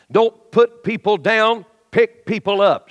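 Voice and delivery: slow, each word punched; slow emphatic slogan. Shown as a demonstration. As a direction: lay down the slogan for them to repeat. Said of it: "Say it".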